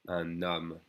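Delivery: «Un homme» linked as un-nomme; N liaison. Shown as a demonstration. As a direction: sound the N between Un and homme in «Un homme». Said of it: "With an N sound".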